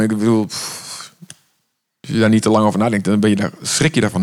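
usual speaking voice

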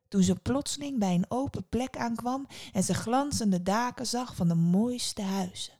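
ordinary delivery

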